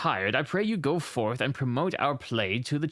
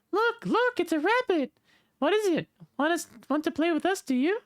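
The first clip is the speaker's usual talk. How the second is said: put-on high excited little girl; 14 semitones higher than usual. Reading the village girl's lines, high girl voice. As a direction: squeal it like a young girl. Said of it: "That girl accent sucked".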